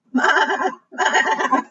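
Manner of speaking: high, stuttering maa; goat bleat. Acted. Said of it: "said the goat".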